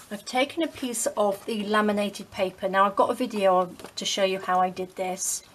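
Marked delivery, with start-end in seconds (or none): none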